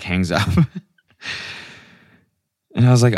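usual speaking voice

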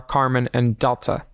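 ordinary talk, nothing marked

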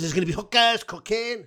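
very high put-on Welsh accent; Pontypridd-Merthyr accent. Fan repeating his road-trip promise, in a very high Pontypridd-Merthyr accent. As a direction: pitch it right up in Pontypridd. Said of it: "I'll do Miss Pontypridd, uh, slash Merthyr".

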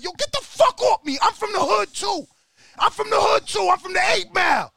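hammed-up yell to be let go; Rocky shouting. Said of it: "He grabbed the Don King like".

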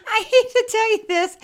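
high, laughing confession; giggling while admitting it. Real, not acted.

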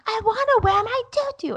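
whiny toddler imitation, very high-pitched; Matilda. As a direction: mimic a little girl wailing in your ear. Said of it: "Matilda's in my ear going".

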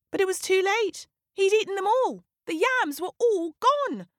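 high, dismayed storytelling; dismayed realisation. A narrator's reading. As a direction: pitch it way up in dawning horror. Narrator voices Leopard's horrified guilty realisation.

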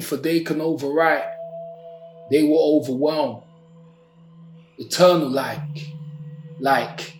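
measured, dramatic poetry recital; spoken word poem. Acted. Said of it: "I have a poem".

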